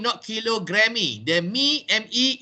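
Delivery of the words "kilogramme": kilogrammy said as "kilogram-ee", ending in mee; mispronounced final E. Shown as a demonstration. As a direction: tack a wrong ee onto kilogrammy. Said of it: "is not pronounced".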